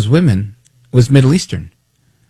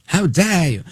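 higher, softer mock outrage on "How dare you?"; lightly voiced indignant activist, higher; "How dare you?" lifted higher and softer, mocking; mock Greta Thunberg; 7 semitones higher, 4 dB quieter, slower than usual. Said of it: "like Greta Thunberg".

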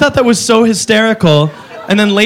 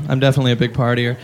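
ordinary talk, nothing marked

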